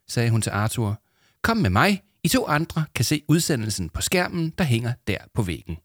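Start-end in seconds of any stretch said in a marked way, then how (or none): 1.4-5.8 much higher, brisk call; busy studio assistant. Acted. Headset lady calling Arthur to the studio, brisk. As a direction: hurry him out. Hørebøfdamen voiced.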